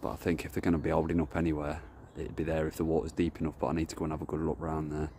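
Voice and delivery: ordinary talk, nothing marked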